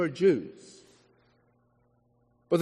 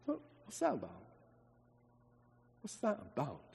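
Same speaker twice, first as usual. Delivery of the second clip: very soft, lower, baffled aside; hushed puzzlement; 5 semitones lower, 20 dB quieter than usual. Acted. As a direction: wonder quietly, as if to yourself. Preacher puzzles over Jesus's abrupt reply.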